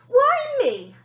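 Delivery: very high, loud indignant demand; a girl wronged and demanding why; hard done by. Acted. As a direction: whine like you've been wronged. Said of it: "demanded the girl, sounding very hard done by".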